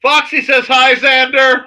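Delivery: loud, high-pitched shouted greeting; calling out. Real, not acted. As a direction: holler the greeting, dragging out the name.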